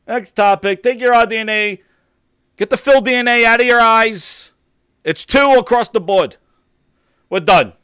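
loud, high, heated ranting. Real, not acted. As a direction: keep yelling the rant. Ranting about console research.

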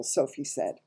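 plain reading voice

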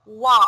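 "wa", open a after w; French oi as wa. Shown as a demonstration. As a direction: say "wa" like the oi in oiseau. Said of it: "we read it".

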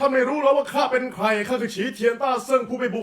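high, shouted Monkey King challenge; Sun Wukong. Shown as a demonstration. Voice actor auditions for the hosts, outraged Monkey King shout. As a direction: bellow indignantly as a legendary monkey warrior. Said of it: "อยากพากย์หงอคง".

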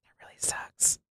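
defeated whisper. Real, not acted. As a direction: breathe it out, beaten. Repeats after death.

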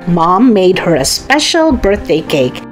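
plain reading voice

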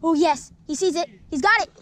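ordinary talk

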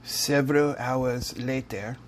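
lightly put-on, slow narrator voice; mock time-skip narrator. Vlogger faking a jump in time, mock narrator. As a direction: draw it out like a joke time card.

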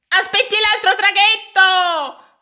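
high, loud, slow call across the water; sailor's shout. A narrator's reading. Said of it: "gridò un marinaio". (richiamo del marinaio, acuto, forte e lento)

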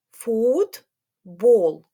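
Футбол said slowly as фут-бол, each syllable marked; syllable-by-syllable demo. Shown as a demonstration. Teacher showing children the two syllables in Футбол. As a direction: chant Футбол in two beats for the class. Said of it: "Попробуємо".

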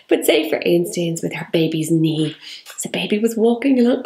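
normal talking voice

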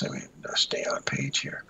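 quick whispered aside to himself. Real, not acted. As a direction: drop to a whisper, muttering off-mic. Aside while reading a message.

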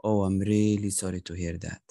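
a touch of sympathy, pitched low; sympathetic. Shown as a demonstration. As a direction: drop the voice to show sympathy. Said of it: "in the sympathy, if it is like bad news".

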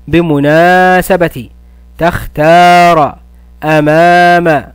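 بمناسبة، تختار، أمام with the alif stretched slowly; long vowels drawn out. Shown as a demonstration. Teacher stretches alif madd words for pupils. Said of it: "أستخرج كلمات تحوي مدا بالألف".

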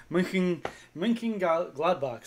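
slow, loud, careful German accent; rough attempt at a German accent. Shown as a demonstration. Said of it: "my German accent, is, uh, a little rough".

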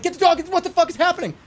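high, loud alarmed shouting; retelling dream chaos with a mock panicked shout; retold panicked yell. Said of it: "And it was like".

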